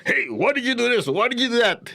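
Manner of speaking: high, stern mom questioning; scolding mom. Retold or imitated. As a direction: mimic a mother scolding her kid. Parent scolding child.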